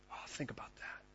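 awed whisper, very soft; whispered aside; hushed whisper. Real, not acted. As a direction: drop to a whisper to let it sink in. Hushed aside after the verse.

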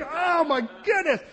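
high, slow exclamation; mock astonishment. Family finding puppy notes everywhere, mock amazed. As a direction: gasp it out in disbelief. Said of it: "Everybody was like".

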